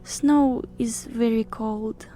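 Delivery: slow whispery harpy voice; a small, slow half-whisper in character; said quietly. A narrator's reading. Harpy hints to Yule she wants carrying, quietly. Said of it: "Eris said quietly".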